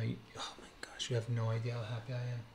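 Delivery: hushed, rushed whisper of joy. Real, not acted. Collector hushing himself so his sleeping daughter won't wake, excited whisper. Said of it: "I have to be quiet 'cause my daughter's sleeping".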